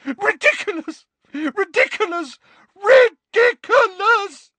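high, frantic, rising shriek; shrieking Molly. A narrator's reading. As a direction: scream it out in rising panic. Mrs Weasley fighting the boggart, shrieking.

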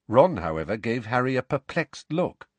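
ordinary delivery, nothing marked

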